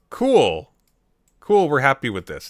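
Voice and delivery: loud, high put-on "cool"; mocking cheery acceptance; mock approval. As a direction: pretend to be happily satisfied, punched up. Mocking the fans' easy acceptance.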